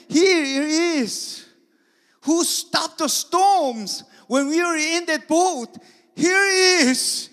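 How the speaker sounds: very high, loud anguished cry; loud anguished wailing; desperate panic. Acted. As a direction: plead in despair. Disciples panicking as Jesus is whipped.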